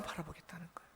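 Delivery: breathy whisper of the answer. Real, not acted. Pastor whispering the answer to the congregation. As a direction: whisper it like a secret.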